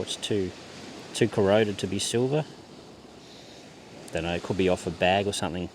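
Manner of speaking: ordinary talk, nothing marked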